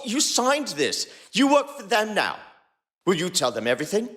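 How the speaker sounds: ordinary delivery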